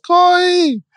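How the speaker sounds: put-on high, small-child voice calling Corey; little girl copying her mother calling out; toddler copying the call. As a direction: imitate a toddler echoing Corey. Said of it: "And Sarai says".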